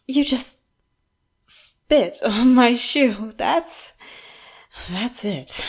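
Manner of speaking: sweet coaxing breaks into loud, high indignation; scoff, then burst out offended, drawing out the words; affronted outrage. Acted. Captor reacts to prisoner who spat on shoe, outraged.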